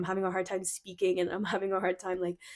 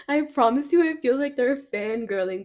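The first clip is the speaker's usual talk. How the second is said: giddy laughter; 6 semitones higher, 7 dB louder than usual. Real, not acted. Reader gushing over the cards.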